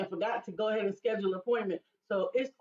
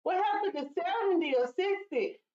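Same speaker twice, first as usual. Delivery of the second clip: surprised disbelief; 10 semitones higher than usual. Retold or imitated. Mock disbelief at daughter's claim.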